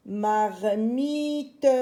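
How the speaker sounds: Marmite drawn out as mar-mii-te; slow teacher's reading of Marmite; syllable by syllable. Shown as a demonstration. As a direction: sound out Marmite slowly with the final te voiced. Teacher sounds out the word.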